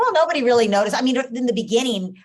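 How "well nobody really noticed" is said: much louder, bright report; imitating a daughter telling her mother the day went fine; relieved daughter. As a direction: tell mom it went fine. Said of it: "she's like".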